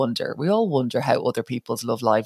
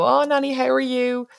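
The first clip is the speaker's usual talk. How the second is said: sweet granddaughter; 10 semitones higher than usual. Retold or imitated. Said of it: "be like".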